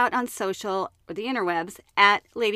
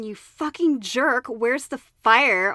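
slow, louder fake fury at the driver; mock angry yell; 3 dB louder, slower than usual. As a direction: drag out the insult in mock rage.

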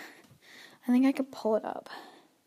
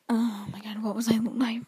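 whispered to self. Real, not acted. Loses track, mutters to self.

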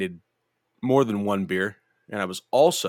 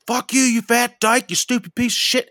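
retold yell; 9 dB louder than usual. Yelling over the fence at the woman on the patio, loud and high. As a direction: bellow the insults across the yard. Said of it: "start yelling over the fence".

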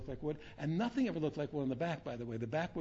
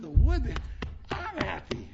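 loud, high burst of delight; joyful outburst; 10 semitones higher, 10 dB louder, slower than usual. Retold or imitated. Telling students he beamed at his Rebbe's table, loud and high with joy. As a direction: blurt out the joy you suddenly feel. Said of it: "I said".

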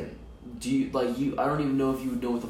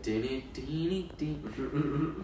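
chime imitation; 4 semitones higher, 5 dB quieter, much slower than usual. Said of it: "Be like".

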